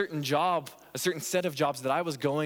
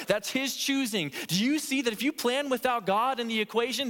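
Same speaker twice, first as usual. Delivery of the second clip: ordinary talk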